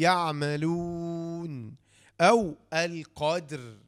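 very slow, each word drawn out; teacher pausing on يعملون and القدر; slow demo of the pause. Shown as a demonstration. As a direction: stop on the last letter to show the sukun. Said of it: "الساكن الثاني متطرف موقوف عليه".

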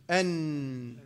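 "أن" with the ن humming through the nose; nasal noon. Shown as a demonstration. Teacher showing where the air exits, nasal hum on "أن". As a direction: hold the ن of "أن" in the nose. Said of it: "من الخيشوم".

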